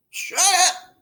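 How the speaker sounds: high, sharp yell; Harry yelling at the warnings. A narrator's reading. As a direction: shout him down. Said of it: "Harry yells".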